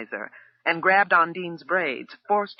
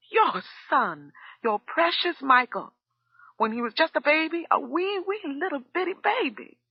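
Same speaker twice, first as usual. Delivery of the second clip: very high, tearful voice; crying as she tells it; starting to cry; 12 semitones higher than usual. A narrator's reading. Cook revealing the abuse to the father, tearful. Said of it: "Undine started to cry".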